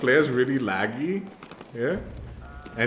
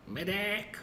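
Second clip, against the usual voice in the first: higher, more urgent call for a medic; man down shout; 9 semitones higher, 6 dB quieter than usual. Real, not acted. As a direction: plead for help, rising in pitch. Downed player calling for help.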